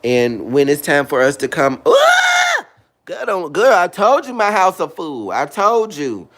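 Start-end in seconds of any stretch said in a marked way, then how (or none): 1.8-2.7 high shrill burst of a scream; sudden scream. Real, not acted. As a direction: let out a sharp excited shriek. Sudden outburst mid-story.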